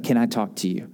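low, soft, subdued plea; retold tearful request. Wrecked bartender asking pastor for a private word, subdued retold tearfulness. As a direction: quietly imitate someone upset, with no real sobbing. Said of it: "He is, like, bawling, crying".

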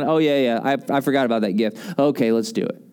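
low, unenthused mumbled concession; reluctant agreement. Acted. Believer reminded to put on bunny suit, reluctant and low. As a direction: give in dutifully, pitch dropped.

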